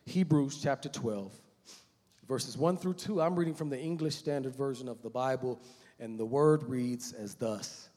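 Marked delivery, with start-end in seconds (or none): none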